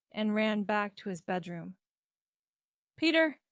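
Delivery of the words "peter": raised, louder call of a name. A narrator's reading. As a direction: call your son to come down. Mother keeps calling son upstairs.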